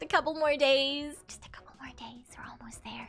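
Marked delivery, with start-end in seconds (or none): 1.3-3.1 hushed excited whisper. Real, not acted. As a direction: whisper it like a secret. Excited countdown, whispered repeat.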